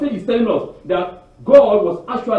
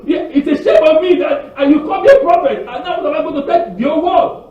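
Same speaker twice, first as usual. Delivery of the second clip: higher, louder, faster protest; impassioned outcry; 7 semitones higher, 4 dB louder than usual. Retold or imitated. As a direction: cry out to God in protest. Moshe protests to God.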